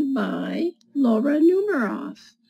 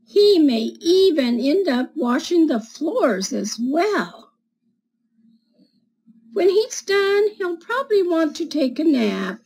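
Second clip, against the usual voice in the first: put-on reading to children. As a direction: read it like storytime for little kids. Reads picture book to children.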